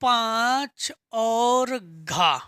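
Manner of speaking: very slow, word by word; drawn-out dictation. Shown as a demonstration. Teacher writing the question number, drawn-out dictation. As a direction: stretch each word while writing it.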